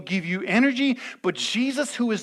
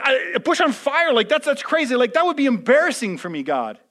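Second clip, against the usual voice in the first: higher, louder flustered Moses; Moses protesting; 6 semitones higher, 5 dB louder than usual. Retold or imitated. Moses voiced telling God it would be embarrassing, higher and louder. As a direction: play Moses balking at God's request.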